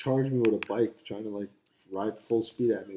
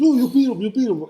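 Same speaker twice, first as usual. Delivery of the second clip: high loud coaxing; eager urging; 11 semitones higher, 7 dB louder than usual. Retold or imitated. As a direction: push him into it eagerly. Friend urging him to fight.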